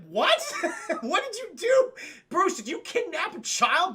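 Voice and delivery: high, loud, laughing disbelief; shocked Alfred. Retold or imitated. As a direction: gasp out the accusation. Alfred shocked by kidnapped kid.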